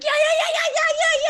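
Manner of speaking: high loud laughing scream; very high, loud laughing yeah yeah yeah; screaming yes. Retold or imitated. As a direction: shriek the yeses with joy. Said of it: "I started screaming".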